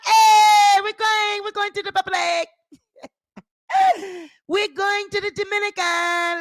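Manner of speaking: high excited calling out; gleeful rallying call. Pretend. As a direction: call everyone to the trip. Continued vacation mock.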